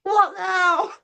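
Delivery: very high squealed protest, laughing; shocked disbelief. Retold or imitated. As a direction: squeal it in comic outrage. Retold second drenching.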